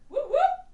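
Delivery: very high, soft "whoop, whoop"; tiny mock whoop. Comic as a millennial cheering debt relief, tiny mock whoop. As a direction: squeak out a small falsetto cheer.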